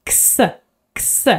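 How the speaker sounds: x as a voiceless ks; x whispered as ks; Swedish X sound. Shown as a demonstration. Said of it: "X stands for two sound".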